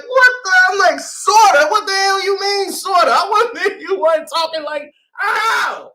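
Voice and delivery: high, loud incredulous ranting; mock fury, laughing through it; retelling his outburst at the girl who said sorta; outraged rant.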